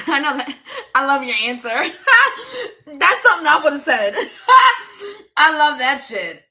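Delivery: cracking up between the words; laughing hard. Real, not acted. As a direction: burst out laughing, delighted. Laughing at a viewer's answer.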